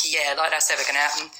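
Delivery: ordinary talk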